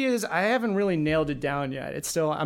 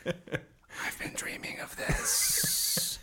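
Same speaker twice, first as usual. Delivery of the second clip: drawn-out, breathy joke whisper; hammed-up intimate whisper; slow mock whisper; 8 dB quieter, much slower than usual. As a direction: whisper it very slowly, playing along with the bit. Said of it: "then slowly whisper".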